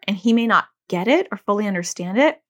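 normal talking voice

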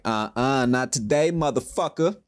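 defiant retort to his own panic; defiant to his brain. Retold or imitated. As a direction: tell the panic off with swagger. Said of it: "I was like".

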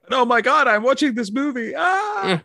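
hammed-up horror ending in a shriek; mock horrified lady. Old lady watching the movie, hammed-up high shriek.

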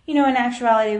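ordinary talk, nothing marked

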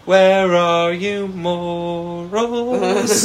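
high, slow, theatrical wail; mock lament. Calling out to Nintendo's missing morals in a high, slow mock wail. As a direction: call out into the void like a lost soul.

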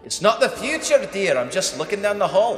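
high, calling from down the hall; hollered from afar. Acted. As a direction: call it from across the room. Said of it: "hollers from down the room".

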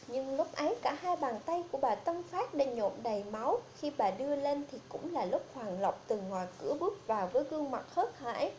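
ordinary delivery, nothing marked

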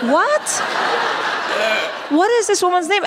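stunned that the name worked; baffled disbelief. Retold or imitated.

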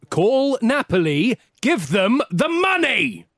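loud, slow, furious command; shouted order. Acted. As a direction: bark the order slowly. Third time, shouted order.

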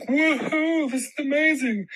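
high, gleeful whoop; a high thrilled "Woohoo"; excited cheer. Retold or imitated. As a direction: squeal with delight at the champagne. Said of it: "And I was like".

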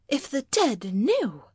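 high, swooping exclamation; reproachful wife. Acted. Wife warns husband about the dead, reproachful.

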